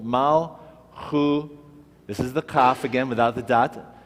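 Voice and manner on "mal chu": Mal and chu split apart with pauses between; teacher breaks the word into syllables. Shown as a demonstration. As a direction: sound out Mal, then chu, separately. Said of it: "makes this the first syllable".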